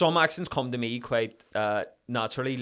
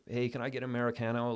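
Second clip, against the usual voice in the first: softer, lightly put-on American accent; casual American accent ordering coffee; 7 dB quieter than usual. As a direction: imitate your method-acting American accent. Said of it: "I was doing that American accent".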